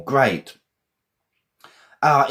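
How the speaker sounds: normal talking voice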